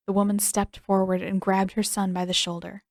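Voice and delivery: ordinary delivery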